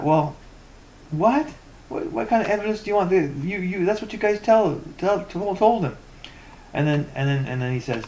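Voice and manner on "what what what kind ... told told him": high-pitched, indignant disbelief; incredulous. Real, not acted. Ranter answering a demand for evidence, incredulous. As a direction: push the pitch up in exasperated disbelief.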